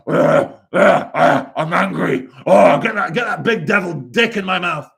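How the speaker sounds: mocking the spammer Marcus, loud grunting parody; mock angry troll. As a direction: ham up a furious grunting troll.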